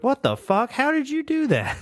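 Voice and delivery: high-pitched, incredulous acted reaction; hammed-up disbelief; mock astonishment. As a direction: play baffled amazement, voice shooting up. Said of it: "And you're like".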